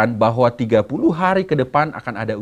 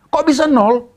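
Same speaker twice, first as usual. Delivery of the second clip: angry mother; 10 semitones higher than usual. Acted.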